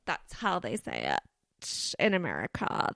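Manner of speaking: a light, mock American Valley Girl accent. Host mocks the American way of saying Delphi in a light Valley Girl accent. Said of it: "That's my Best Valley Girl voice".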